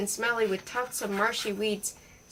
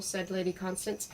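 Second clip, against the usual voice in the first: ordinary delivery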